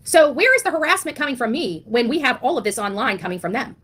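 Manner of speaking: ordinary talk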